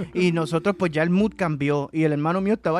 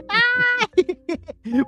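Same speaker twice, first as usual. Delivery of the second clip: sudden high yelp of pain, much higher; 5 dB louder than usual. Retold or imitated. Little brother on the bike seat, high pained yelp. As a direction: yelp like a bouncing seat hurts. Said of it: "estaba detrás de mí gritando".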